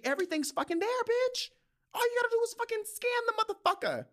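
fed up, pitched high and fast; exasperated high rant. Real, not acted. Angry rant at lazy coworkers.

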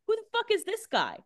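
high-pitched mock disbelief; acted-out indignant bafflement; incredulous. Voicing Enzo's disbelief at newcomer.

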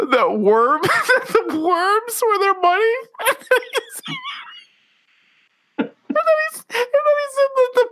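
high-pitched wheezing laugh over every word; hysterical laughter. Real, not acted. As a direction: barely get the words out for laughing. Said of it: "how fucking hard you're laughing right now".

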